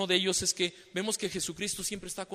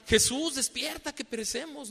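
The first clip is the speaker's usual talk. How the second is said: frightened disciples; 8 semitones higher than usual. Retold or imitated. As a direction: call out as if the boat is sinking. Disciples cry out in storm.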